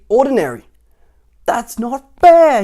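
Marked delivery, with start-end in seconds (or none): 1.5-2.6 slow, very high, loud protest; whiny cry. Acted. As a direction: wail it like a spoiled child. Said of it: "he cried".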